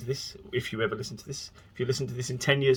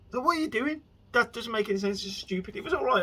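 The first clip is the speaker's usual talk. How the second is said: exasperated yelling at screen; 12 semitones higher than usual. Retold or imitated. Viewer shouts at zombie show characters in high exasperation. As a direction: throw up your hands at the dumb characters. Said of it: "since I shouted at the screen so much".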